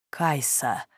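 low, soft murmur of her name; tender whisper. A narrator's reading. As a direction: breathe the name softly, as after a kiss. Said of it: "прошептал Эдриан".

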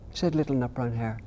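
put-on gentle read-aloud for children; storybook reading. A narrator's reading. Author reading his bedtime book aloud to interviewer, gentle storytelling.

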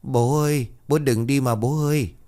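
put-on a small boy begging his grandfather not to leave; child crying after his dead father, pleading; whimpering child. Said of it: "nó liền mếu máo".

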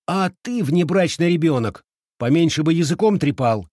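high, loud telling-off; sharp scolding. A narrator's reading. Girl silences the chattering boy, scolding loudly. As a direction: shout him down crossly. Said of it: "прикрикнула на него Фэн Хуан".

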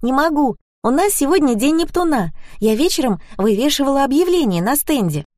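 high-pitched, lively woman friend; insistent cheer, pitched well up; perky Zhenya. A narrator's reading. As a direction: chirp it like an unstoppable organiser. Narrator voices Zhenya.

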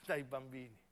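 ordinary talk

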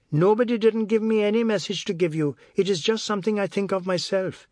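high-pitched defensive protest; indignant retort. A narrator's reading. Wife denying any message to husband, indignant retort. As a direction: answer back sharply.

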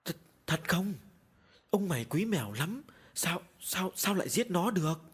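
panicked disbelief. A narrator's reading. Wife hearing her husband killed his cat, panicked. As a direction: stumble over the words in shocked panic. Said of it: "hốt hoảng kêu lên".

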